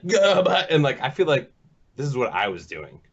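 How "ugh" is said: strained grunt. Retold or imitated.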